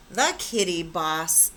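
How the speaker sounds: ordinary delivery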